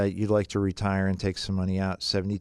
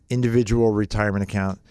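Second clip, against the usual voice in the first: Individual punched hard, the rest brisk; stress on individual; 3 dB louder, faster than usual. Shown as a demonstration. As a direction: lean on Individual, then rush through. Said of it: "emphasis on the word individual".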